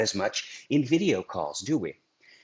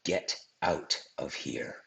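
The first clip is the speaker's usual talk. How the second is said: much lower and softer, slow get out of here; slow, hushed warning; low quiet menace; 8 semitones lower, 7 dB quieter, slower than usual. Shown as a demonstration. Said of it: "Or I could say".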